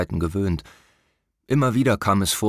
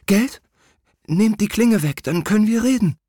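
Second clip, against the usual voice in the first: high, louder, pleading in fear; frightened prince; 14 semitones higher, 4 dB louder than usual. A narrator's reading. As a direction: bargain for your life, panicking. (verängstigter Prinz)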